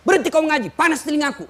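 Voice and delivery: high, loud protest; angry outburst. Retold or imitated. Patient ordering the preacher to stop reciting, loud and high. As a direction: cut him off with a high, angry cry.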